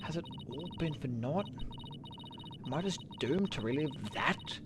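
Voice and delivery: soft, sinking voice of a lawyer who feels she failed; quiet despairing lawyer. Acted. Athena doubting herself after the mock trial, quiet and despairing. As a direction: let it drain out of her, hushed.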